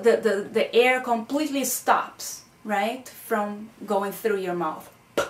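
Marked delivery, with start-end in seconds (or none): 5.2-5.2 P as a lone pop of the lips, no vowel; P with the air fully stopped by the lips, then released; bilabial stop. Shown as a demonstration. Teacher showing students how air stops, P as a lip pop. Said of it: "lips are completely stopping".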